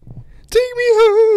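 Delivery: very high, loud, drawn-out fake cry; hammed-up pleading howl; devastated mock wail. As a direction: bawl it like a heartbroken drunk girl. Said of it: "the girl was devastated".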